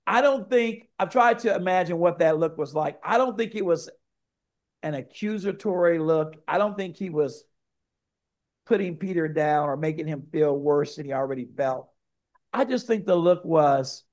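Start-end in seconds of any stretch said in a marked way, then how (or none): none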